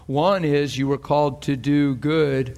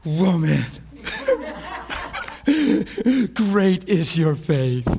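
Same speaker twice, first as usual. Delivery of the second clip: amazed laughter breaking through slow words; marveling; 4 semitones higher, slower than usual. Acted. Jesus to the woman at his feet, laughing marvel. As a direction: laugh in wonder, then draw it out. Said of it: "two times Jesus marveled".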